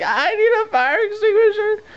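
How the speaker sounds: very high, near-crying plea; panicked cry. Retold or imitated. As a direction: beg for it, voice cracking. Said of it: "and I'm like".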